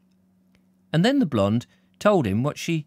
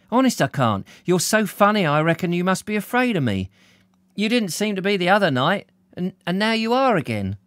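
higher-pitched teasing blonde; blonde's voice; 8 semitones higher, 3 dB louder than usual. Acted. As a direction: play the blonde, pitched up and puzzled by him.

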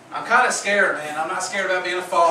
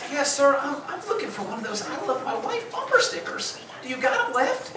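higher, meek customer voice; lightly voiced eager customer; polite customer voice; 6 semitones higher, 5 dB quieter than usual. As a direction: ask sheepishly like a timid shopper. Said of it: "And he's like".

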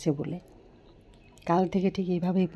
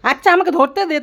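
excited loud; 10 semitones higher, 11 dB louder than usual. Real, not acted. Mother guiding blindfolded daughter.

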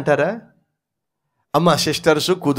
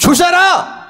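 angry shout; 11 semitones higher, slower than usual. Acted. Wife protesting to husband.

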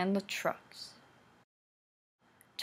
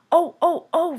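high, loud startled cries; alarmed trucks crying out high and loud; trucks screaming; 8 semitones higher, 8 dB louder than usual. A narrator's reading. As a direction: yelp as the couplings jerk tight. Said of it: "they screamed".

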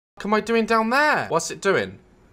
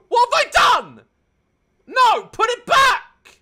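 panicked shout; 12 semitones higher, 5 dB louder than usual. Real, not acted. Gamer panicking as the food falls, shouting. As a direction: shout it in alarm.

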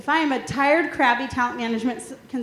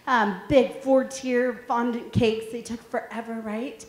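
ordinary talk

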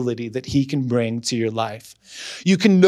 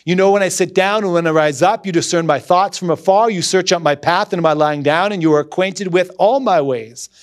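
full-voiced psalm reading, louder and higher; loud scripture reading; 4 semitones higher, 4 dB louder than usual. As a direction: declaim the psalm with rising fervour. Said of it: "I'm gonna read a few verses".

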